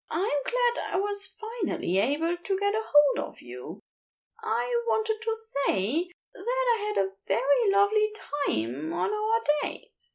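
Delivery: high, hopeful confession; shy Aziraphale. Acted. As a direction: nervously work up to it. Aziraphale gathers courage.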